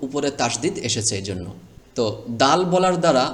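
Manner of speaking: ordinary talk, nothing marked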